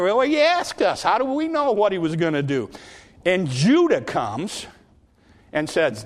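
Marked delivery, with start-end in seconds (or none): 0.0-2.7 high-pitched, exasperated comeback; mock-indignant self-defence; defensive protest. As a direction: protest your innocence, acted out. Sons defend themselves to father.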